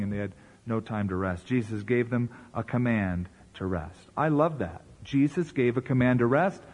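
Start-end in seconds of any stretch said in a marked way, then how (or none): none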